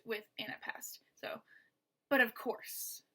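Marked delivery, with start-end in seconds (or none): 2.1-3.0 "but of" kept light, "course" stressed. Shown as a demonstration. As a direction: land on "course" after two unstressed syllables. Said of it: "two unstressed, then stressed".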